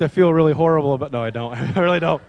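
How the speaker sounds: ordinary talk, nothing marked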